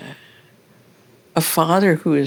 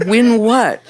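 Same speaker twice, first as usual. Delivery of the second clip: louder, higher baffled question; baffled child; 6 semitones higher, 5 dB louder than usual. Retold or imitated. Child baffled by mother's question, louder and higher. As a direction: blurt it out in confusion. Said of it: "I was like".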